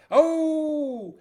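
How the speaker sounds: high, loud surprised oh. Real, not acted. Host spotting a viewer, a high loud surprised oh. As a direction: yelp of delight.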